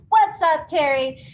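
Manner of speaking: loud, high shout-out greeting; calling out. Real, not acted. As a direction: holler hello to someone joining the call. Greeting an attendee on the call.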